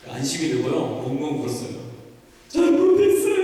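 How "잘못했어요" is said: put-on high wailing child's sob, sung out loud; sobbing apology. A child sobbing sorry to his mother, high and wailing. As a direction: cry it out like a scolded kid. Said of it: "이러고 엉엉 울었어요".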